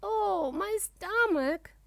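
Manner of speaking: high, drawn-out groaning complaint; pained cry. A narrator's reading. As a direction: cry out slowly about a bellyache. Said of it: "Dragon cries".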